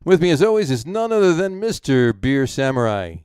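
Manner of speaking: big, drawn-out podcast welcome; punchy host intro, loud and high at each start; show-opening announcer. Acted. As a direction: announce the show, stretching the key words.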